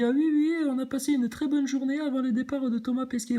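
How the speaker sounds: high mocking southern French accent. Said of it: "je prends toujours l'accent du Sud quand je fais le gogol".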